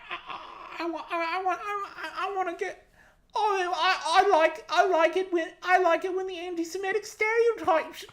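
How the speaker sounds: very high, laughing gloating voice; megalomaniac rant. Retold or imitated. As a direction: cackle through a mad boast. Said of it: "I'm being real fucking mean".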